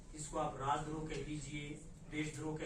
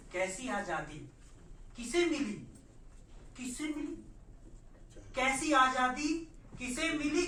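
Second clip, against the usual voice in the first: loud, raised, challenging declamation; forceful poem recital; 8 semitones higher, 8 dB louder than usual. Acted. Poet confronting silent intellectuals in verse, loud high declamation. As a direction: hurl each question at the listeners.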